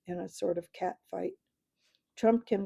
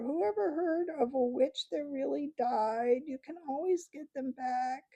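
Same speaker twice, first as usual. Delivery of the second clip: put-on much higher, wheedling old crone voice; whining hag; 7 semitones higher than usual. Hag flatters the doctor in a whining crone voice. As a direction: simper like a fawning old witch. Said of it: "the shrill whining voice began".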